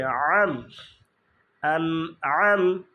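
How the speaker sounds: "আন আম" with "আম" pressed from the throat; throat-squeezed ain. Shown as a demonstration. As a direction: hold "আন", then squeeze out "আম". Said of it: "কণ্ঠনালীকে চেপে". ("আম" কণ্ঠনালী চেপে)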